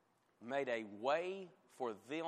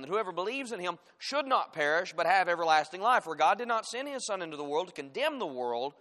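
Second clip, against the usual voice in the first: loud, higher, forceful preacher's reading; scripture reading; 5 semitones higher, 10 dB louder than usual. Acted. Preacher reading verses to the congregation, forceful scripture reading. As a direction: proclaim the verses with full force.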